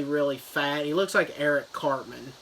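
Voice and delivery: ordinary delivery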